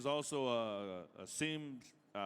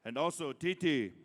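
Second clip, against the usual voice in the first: powwow emcee; 4 dB louder than usual. Acted. Calling names at a powwow.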